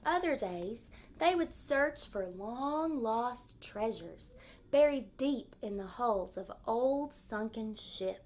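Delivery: gentle storybook read-aloud; lively story narration for young kids; reading to children. A narrator's reading. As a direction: read it like a picture book for the class. Teacher reads picture book aloud.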